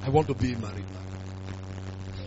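ordinary delivery

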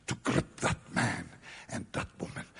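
whispered and low; hushed whisper. Real, not acted. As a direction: let it fall to a breath. Preacher hushes climax image.

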